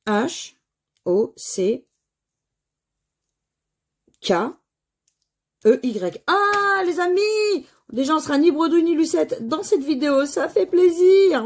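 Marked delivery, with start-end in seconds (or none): 6.3-11.4 excited cheer. Real, not acted. A winning word is found.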